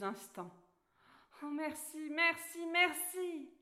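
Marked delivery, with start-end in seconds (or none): 1.4-3.5 high, excited thanks building louder; jumping for joy, thank him eagerly; joyful cry. Acted. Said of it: "cria Alice en sautant de joie".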